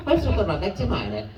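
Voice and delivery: high, slow cartoon Italian; Italian accent. Shown as a demonstration. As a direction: play a little kid's claim in an Italian accent. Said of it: "but with an Italian accent".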